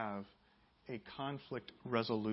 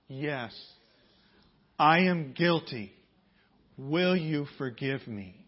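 slow, louder, word by word for the congregation to repeat; slow call and response; 9 dB louder, slower than usual. Shown as a demonstration. Pastor leading the congregation in a repeated confession, slow and louder. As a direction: lead the room through it slowly. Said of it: "Repeat after me".

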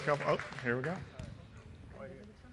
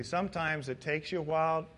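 usual speaking voice

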